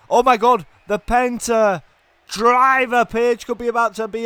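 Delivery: high, loud big-move call; excited shout. Real, not acted. Commentator reacting to the Penta driver, excited shout. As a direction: cry out as the finisher lands.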